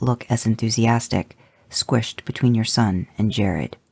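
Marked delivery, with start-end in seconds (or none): none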